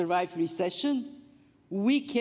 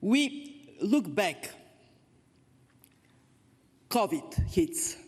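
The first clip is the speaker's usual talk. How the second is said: losing voice; slower than usual. Real, not acted. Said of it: "I'm losing my voice".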